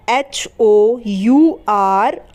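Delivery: very slow spelling, each letter held; spelling slowly. Shown as a demonstration. Teacher spelling hour slowly before explaining silent H. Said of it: "यहाँ पर एच जो होता है ना".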